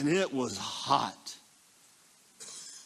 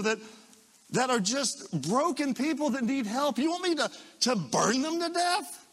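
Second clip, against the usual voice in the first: incredulous Jesus; 8 semitones higher than usual. Retold or imitated. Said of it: "He goes".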